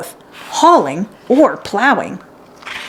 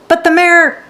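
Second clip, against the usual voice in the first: pitched well higher, a bit louder protest; girl protesting; 9 semitones higher, 3 dB louder than usual. A narrator's reading. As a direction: start to object before being cut off.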